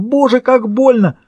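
high, drawn-out agonised wail; yelling in pain. A narrator's reading. Burned prisoner cries to God, yelling in pain. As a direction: stretch the cry out high and slow. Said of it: "заорал он".

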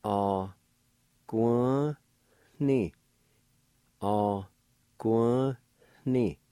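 "guan" with a nasal N, syllable by syllable; nasal "guan, ni" said slowly; nasalized syllables. Shown as a demonstration. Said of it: "it'll sound like this".

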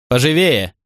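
barked order. A narrator's reading. Commander hurrying centurions in.

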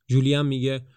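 plain reading voice

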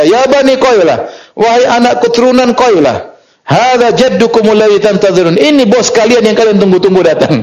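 high-pitched heralding cry, lightly acted; imitated loud call. Lookout announcing the awaited leader's arrival, imitated high call. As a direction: call out the big news, mimicking a shouter. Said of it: "dengan suaranya yang paling kencang dia berkata".

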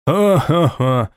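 slow, hearty ha-ha-ha laugh; group guffaw. A narrator's reading. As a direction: roar with laughter together. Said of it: "заржали всей кучей".

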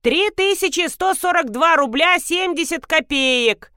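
high-pitched rattled-off report. A narrator's reading. Old pharmacist reporting the price to the customer, high-voiced. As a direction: announce it high like a formal report. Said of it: "отрапортовал божий одуванчик".

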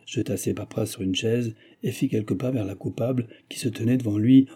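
ordinary delivery, nothing marked

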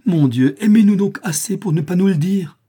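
loud, high-pitched plea; desperate exclamation. A narrator's reading. Young woman begging her suitor to stay silent, exclaiming desperately. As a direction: cry out in exasperated despair. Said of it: "s'écria-t-elle, en levant un regard désespéré".

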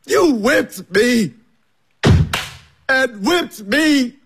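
building anguish. Acted. Accusing Caleb, building anguish.

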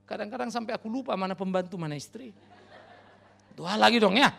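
ordinary talk, nothing marked

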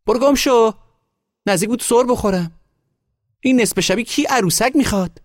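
very high, loud scolding woman; angry yelling. A narrator's reading. Woman nearly slipped in snow, yelling at the boy. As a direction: yell them away in a high voice. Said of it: "زنک سرش داد زد".

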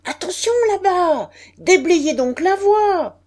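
loud, high shouted warning down the platform; shouting at the top of his lungs. A narrator's reading. Stationmaster yelling at people to clear the track, loud shout. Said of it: "qui criait de tous ses poumons".

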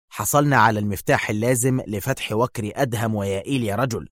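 ordinary delivery

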